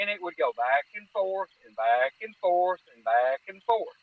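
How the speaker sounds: normal talking voice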